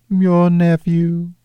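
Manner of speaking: high, otherworldly spirit voice; ghost voice. Acted. Ghost recalling Scrooge's sister, high spirit voice. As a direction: speak as a gentle, distant ghost, pitched high.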